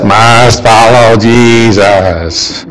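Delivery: slow, low robotic monotone; stretched-out robot voice; mock robot. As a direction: drone it out like a programmed robot. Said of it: "We're all just like robots".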